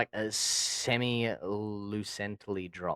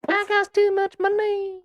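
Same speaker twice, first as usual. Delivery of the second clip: squeaky pitched-up tease; high filtered voice; 6 dB louder, slower than usual. Pretend. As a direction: taunt in a chipmunk voice.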